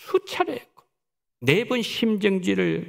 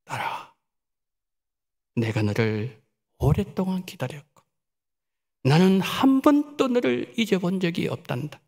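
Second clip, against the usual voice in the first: gentle loving father voice; tender voice of God; 4 semitones lower than usual. Retold or imitated. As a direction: speak to a daughter you never forgot. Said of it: "하나님께서 그녀에게 이렇게 말씀하셨어요".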